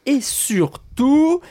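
mock-grand emphasis; drawn out. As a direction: stretch "et surtout" very slowly and high.

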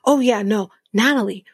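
usual speaking voice

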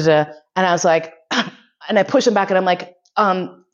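ordinary talk

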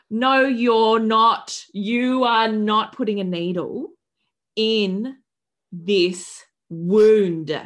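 slow, loud, word-by-word refusal; outraged refusal. Retold or imitated. Torn mother refusing the stitching needle, slow and loud. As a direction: draw out each word and forbid it forcefully.

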